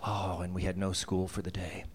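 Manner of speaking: ordinary talk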